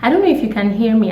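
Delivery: ordinary talk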